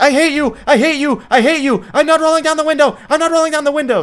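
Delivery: put-on loud, high-pitched kid screaming fit; bratty tantrum. Little brat defies her mom on a road trip, shrill mock tantrum. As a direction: throw a hammed-up brat's tantrum. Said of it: "like, a little brat".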